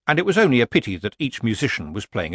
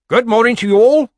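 high, loud, over-nice greeting; strangely nice; 13 semitones higher, 11 dB louder than usual. A narrator's reading. Granny Weatherwax greeting the committee, strangely nice. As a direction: ring out a suspiciously nice hello. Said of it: "it had that strange edge to it again. It sounded nice".

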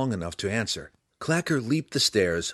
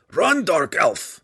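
slow, high, loud shout; urgent cry; 9 semitones higher, 8 dB louder, slower than usual. A narrator's reading. Belwar warning the drow to flee, crying out. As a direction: shout each word as a warning. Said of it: "Belwar cried".

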